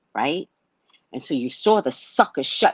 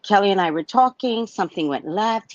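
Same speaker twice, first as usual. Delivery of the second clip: normal talking voice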